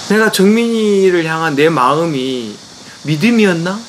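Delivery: ordinary talk, nothing marked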